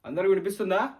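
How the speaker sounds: calling out loud to the whole room; loud mic check. Acted. Student tests the mic before the class, loudly. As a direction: boom it out like testing a mic.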